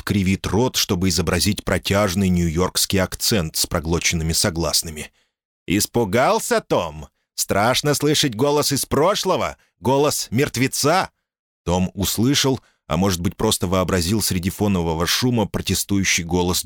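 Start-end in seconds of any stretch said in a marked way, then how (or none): 5.7-11.1 put-on high, slow, taunting American accent; mocking American accent. Caller taunts Tom in Dickie voice.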